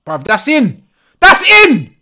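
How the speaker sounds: loud, rising to a high scream of joy; goal celebration. Real, not acted. Fan seeing his team score, ecstatic high yell. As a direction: yell it as the ball hits the net.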